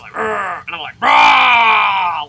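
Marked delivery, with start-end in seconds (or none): 0.1-0.6 short grumpy growl; imitated old-person growl. Player imitating an old person growling at him, short mock growl. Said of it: "Like, the old person". 1.0-2.3 huge, long monster roar; Sully. Acted. Man out-growling an old person, giant monster roar. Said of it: "Like fucking Sully from Monsters".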